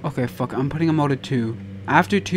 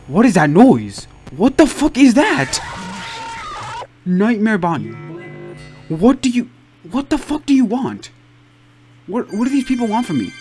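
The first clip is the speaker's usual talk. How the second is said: scared horror game streamer; 6 semitones higher, 4 dB louder than usual. Real, not acted. Frightened by game noises.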